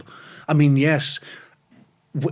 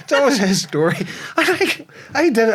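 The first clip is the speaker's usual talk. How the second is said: laughing; 8 semitones higher than usual. Real, not acted. Laughing at the story.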